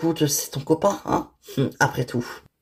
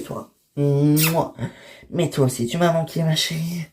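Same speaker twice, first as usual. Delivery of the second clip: usual speaking voice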